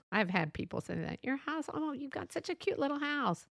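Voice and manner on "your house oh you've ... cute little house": high, soft mock-sweet coo; passive-aggressive gushing. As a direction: imitate condescending praise, softly and sweetly. Passive-aggressive friend praises house.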